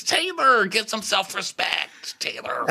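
high, slow pleading; exasperated plea. Acted. Host begging Taylor to wise up, high then fading. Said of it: "talking to Taylor".

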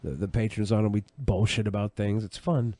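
ordinary talk

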